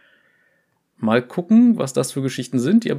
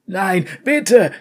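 louder, higher cry of panic; Devaki screams; 7 semitones higher, 5 dB louder than usual. Acted. Devaki begs Vai to stop hurting him, screaming. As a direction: cry out begging for mercy. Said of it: "schrie Devaki".

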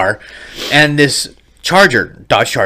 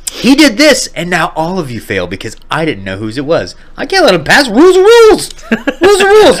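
mock stern professor; 3 semitones higher than usual. Imagined professor scolding class.

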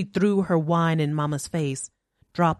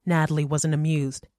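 usual speaking voice

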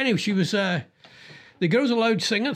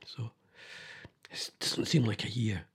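whispered grief; 7 semitones lower, 9 dB quieter than usual. Real, not acted. Remembering late mother-in-law.

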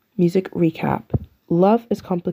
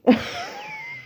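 a sudden high squeal of laughter at herself; 4 dB quieter than usual. Real, not acted. Podcaster laughing at her Audacity joke, high squealing laugh. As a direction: burst into a high, giddy laugh.

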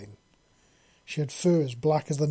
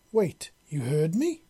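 slow, louder astonished question; surprised exclamation; 3 semitones higher, 5 dB louder, slower than usual. A narrator's reading. Queen astonished the hatchling heard her, surprised. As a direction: catch yourself in disbelief. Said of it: "she exclaimed".

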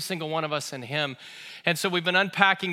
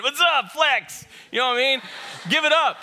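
calling out; 6 semitones higher than usual. Real, not acted. Greets Angel in the congregation.

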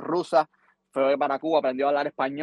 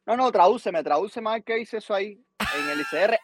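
imitating insistent host; 5 semitones higher than usual. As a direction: press him like a nervous radio host. Radio woman insisting on translation.

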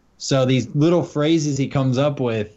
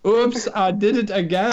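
put-on Swedish accent; 7 semitones higher than usual. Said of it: "in a Swedish guy's voice".

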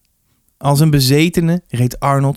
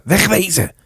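urgent, high hiss; hissed warning; 7 semitones higher than usual. A narrator's reading. Boy urging his mates to flee, hissed. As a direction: hiss at your mates to run. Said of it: "hoorde Arnold sissen".

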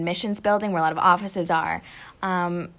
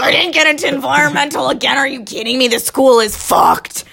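furious rant; 6 semitones higher, 9 dB louder than usual. Retold or imitated. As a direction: rant in frustration, pulling your hair out. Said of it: "pulling my hair out".

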